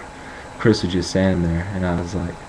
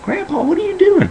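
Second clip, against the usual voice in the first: very high mock squeal of horror; shocked squeal; 4 dB louder than usual. Grandson walking in on naked grandpa, mock shocked squeal.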